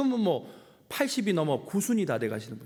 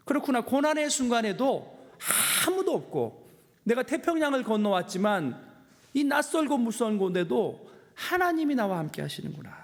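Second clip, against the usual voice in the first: higher-pitched, earnest declaration; fervent realization; 6 semitones higher than usual. Retold or imitated. Preacher retelling his lonely moment of finding God with him, fervent realization. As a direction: let the realization rise and carry.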